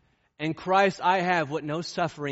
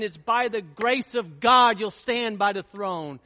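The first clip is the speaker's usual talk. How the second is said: raised, loud preacher's fervor; fiery pulpit delivery; full-force preaching; 5 semitones higher, 7 dB louder than usual. Acted. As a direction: preach it out, high and hard. Preaching rises again.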